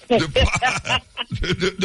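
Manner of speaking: real laughter breaking up the words; laughing mid-sentence. Real, not acted. Cracks up at sounding like Trump.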